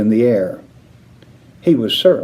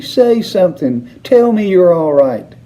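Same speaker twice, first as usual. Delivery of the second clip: pleading cry; 8 semitones higher, 4 dB louder than usual. A narrator's reading. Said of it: "cried Missus Teavee".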